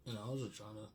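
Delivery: ordinary talk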